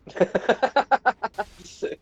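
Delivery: loud, high falling laugh. Real, not acted. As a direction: crack up laughing. Laughing at the fight.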